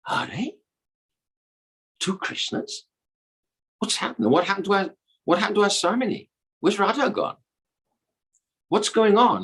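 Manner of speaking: high, puzzled voices of the gopis; high-pitched, baffled questioning; bewildered gopis. Acted. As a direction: play confused girls, pitched well up.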